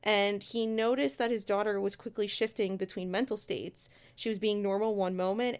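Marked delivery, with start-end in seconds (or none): none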